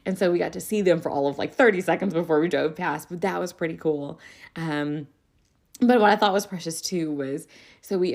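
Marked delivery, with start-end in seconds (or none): none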